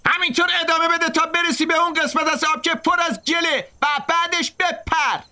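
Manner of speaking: high yell up the tree; shouted orders. A narrator's reading.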